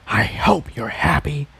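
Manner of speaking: unvoiced bitter whisper under breath. A narrator's reading. Jigsaw whispers bitterly to the fragment. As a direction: hiss it to the orb privately. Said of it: "he whispered under his breath".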